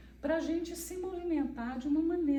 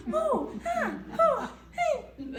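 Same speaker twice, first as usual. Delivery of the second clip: high, swooping mock gasps; exaggerated expressive exclamations; 12 semitones higher, 5 dB louder, much slower than usual. Shown as a demonstration. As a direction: ham up the ohs and ahs way up high. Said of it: "A gente não tem aquele".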